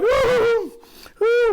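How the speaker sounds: very high, held "ooh"; mock frightened squeal. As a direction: imitate a helpless heroine's squeal. Acts out helpless frightened heroine.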